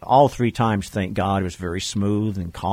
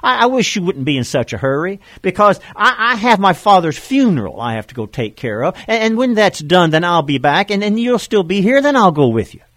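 hesitant excuses; 9 semitones higher, 5 dB louder than usual. Retold or imitated. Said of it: "this other man said".

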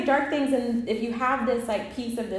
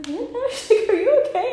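friend's worried question; 12 semitones higher, 6 dB louder, faster than usual. Retold or imitated. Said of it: "she was like".

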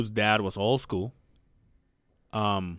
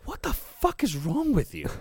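wife scolding; 8 semitones higher than usual. Retold or imitated. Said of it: "she goes".

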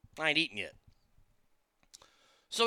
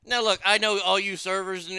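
ordinary talk

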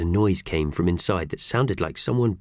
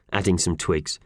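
plain reading voice

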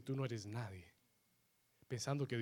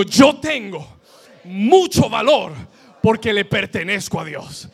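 very loud, much higher shouted declaration; shouted preaching; 6 semitones higher, 18 dB louder than usual. Acted. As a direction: shout it out for the whole church to repeat. Congregation repeats after preacher.

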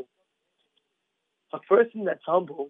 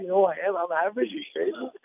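ordinary talk

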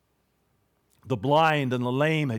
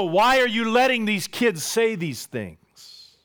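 indignant challenge; 7 semitones higher than usual. Retold or imitated. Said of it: "They want to know".

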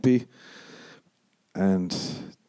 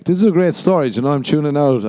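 louder, higher sarcastic aside; self-mocking sarcasm; 6 semitones higher, 5 dB louder, faster than usual. Comic mocking his own rambling story, loud sarcastic aside. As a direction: roll your eyes at yourself. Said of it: "mocking own story".

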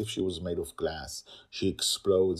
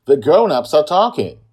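loud, higher dismissive shout; same shouting tone; 10 semitones higher, 17 dB louder than usual. Acted. Aunt dismissing the girl, loud shout. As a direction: put the child in her place loudly. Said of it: "gives her back the same tone".

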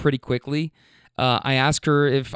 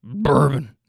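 put-on old guy voice. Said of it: "he repeats the word again".

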